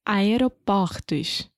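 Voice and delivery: Aeroportos, slow, with open ó in portos; open vowel plural. Shown as a demonstration. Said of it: "Fala de novo".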